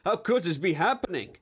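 high-pitched incredulous question; disbelief. A narrator's reading. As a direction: voice lifting in stunned disbelief. Maimed man in disbelief.

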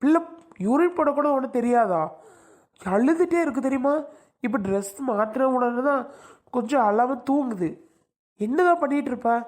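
high-pitched, exasperated telling-off; angry scolding. Acted. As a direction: scold your wife for neglecting the baby. Husband scolds wife over baby's neglect. (கோபமாக உயர்ந்த குரலில் திட்டுதல்)

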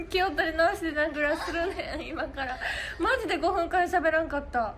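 giggling through the words, voice lifted; can't stop laughing while trying to restart. Real, not acted. Host trying to regain composure, laughing helplessly. Fit of giggles mid-stream.

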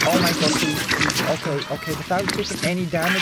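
ordinary talk, nothing marked

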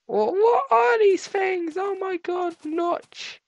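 high-pitched, drawn-out astonishment; astonished. Real, not acted. Player seeing strange things in the game, high-pitched astonishment. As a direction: pitch it way up in disbelief.

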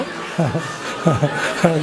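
low chuckling laughter; laughing. Real, not acted. Comedian laughing after the grandpa joke, low chuckle. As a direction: crack up at the punchline.

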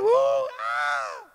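high mock scream of terror; long, piercing comic scream; frightened scream. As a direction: shriek in hammed-up fright. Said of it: "they screamed out with fright".

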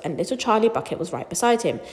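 usual speaking voice

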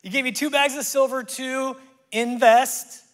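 high, loud report to the master; servant's loud, high-pitched declaration. Acted. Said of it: "came forward and said".

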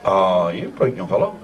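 normal talking voice

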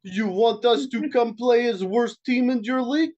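hammed-up Russian accent, haggling. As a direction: put on a comic Russian accent. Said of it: "I could do a good Russian accent".

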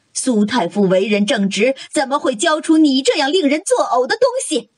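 high-pitched indignant rebuke; outraged mother's scolding; angry scolding. Acted. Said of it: "宁王妃怒视苏云锦".

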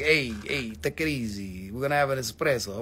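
low, laid-back Sicilian mobster; Sicilian accent. Acted. Sicilians butting in on the tanzanite deal, low Sicilian accent. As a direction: put on a quiet Sicilian accent. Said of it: "the Sicilians got involved and they were like".

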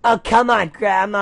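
high, loud whiny shout; shouted back. Acted. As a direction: yell back like a teen. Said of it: "I shouted back".

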